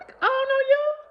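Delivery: high squeaky refusal; very high loud brush-off; mock wary. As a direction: squeak it warily. Retold retort to caller.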